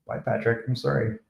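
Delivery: usual speaking voice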